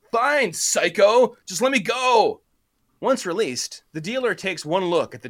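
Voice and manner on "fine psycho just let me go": high, loud, slow protest; panicked yell. Acted. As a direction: yell while arm is twisted. Dealer yelling with arm twisted.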